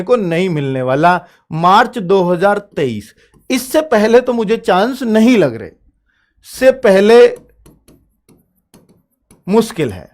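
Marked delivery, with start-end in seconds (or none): none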